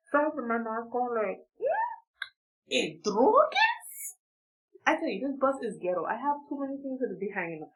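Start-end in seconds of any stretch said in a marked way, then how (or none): 1.6-4.2 high, drawn-out questioning; mock surprise. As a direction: stretch out a high, incredulous question. Mock reaction to pills on bus.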